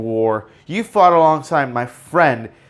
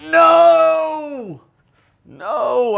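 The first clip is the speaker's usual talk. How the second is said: long, high-pitched wailing "no"; hammed-up drawn-out cry of grief; anguished cry; 14 semitones higher than usual. Fan reliving an emotional tearjerker, hammed-up anguished cry. Reenacting his reaction to emotional scene.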